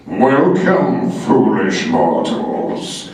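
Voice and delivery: booming, drawn-out ghostly host impression; imitated eerie tones, stretched out long; haunted-house narrator. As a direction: intone it slowly like a spooky theme-park ride. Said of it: "I'm listening to these tones".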